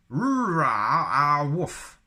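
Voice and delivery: loud, high, slow mock Wookiee howl. Shown as a demonstration. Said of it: "blue sky thinking".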